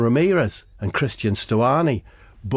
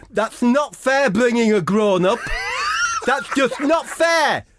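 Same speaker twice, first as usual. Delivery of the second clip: put-on whiny kid protest; 12 semitones higher than usual. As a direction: whine like a kid who lost. Whining kid, high.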